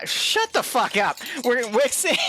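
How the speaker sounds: normal talking voice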